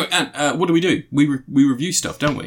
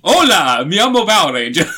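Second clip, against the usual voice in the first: mock Spanish toy voice; 6 semitones higher, 7 dB louder than usual. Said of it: "review the Power Rangers toy".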